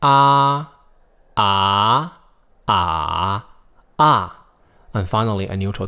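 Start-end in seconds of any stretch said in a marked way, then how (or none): 0.0-0.7 AH high, loud and level; high flat tone. Shown as a demonstration. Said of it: "here are the four tones". 1.4-2.2 AH sweeping upward; rising tone. Shown as a demonstration. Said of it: "here are the four tones". 2.7-3.5 AH held low with a dip. Shown as a demonstration. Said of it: "here are the four tones". 4.0-4.5 AH short, dropping from high; falling tone. Shown as a demonstration. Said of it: "here are the four tones".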